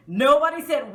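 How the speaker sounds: ordinary talk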